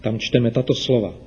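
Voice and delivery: ordinary delivery, nothing marked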